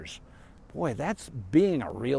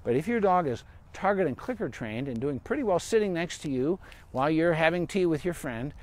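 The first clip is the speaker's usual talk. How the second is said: usual speaking voice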